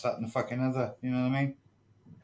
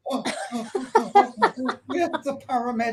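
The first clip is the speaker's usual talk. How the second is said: high, laughing fake panic; mock heart attack; 11 semitones higher, 4 dB louder than usual. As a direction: ham up a collapse with gasping oh's. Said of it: "I am having a heart attack".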